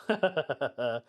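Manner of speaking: short run of chuckles. Real, not acted. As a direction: chuckle briefly to yourself. Laughs after own remark.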